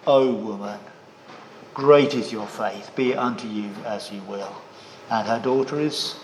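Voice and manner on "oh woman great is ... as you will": a hint of a gentle lord's voice; tender. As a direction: speak it softly, like a kind master. Jesus commends the woman's faith.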